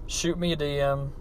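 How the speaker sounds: usual speaking voice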